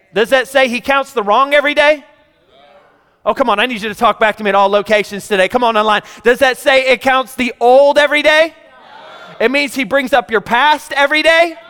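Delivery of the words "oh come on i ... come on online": ordinary delivery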